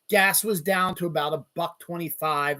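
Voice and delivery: usual speaking voice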